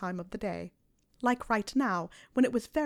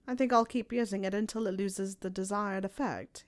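plain reading voice

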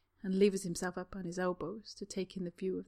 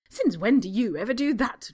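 louder, indignant demand; 4 semitones higher, 10 dB louder than usual. Acted. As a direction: challenge him pointedly. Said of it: "Draco demands".

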